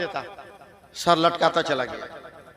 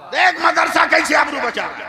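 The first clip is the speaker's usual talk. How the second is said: preaching at full force; 11 semitones higher, 11 dB louder than usual. Real, not acted. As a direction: proclaim it to the crowd. Repeats climactic appeal. (पूरे जोश से तक़रीर)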